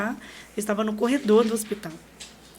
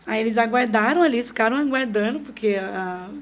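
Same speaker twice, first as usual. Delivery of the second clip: ordinary talk, nothing marked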